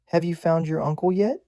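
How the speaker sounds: put-on girlish eager question. A narrator's reading. Gushing girl act continues.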